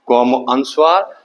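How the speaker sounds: ordinary delivery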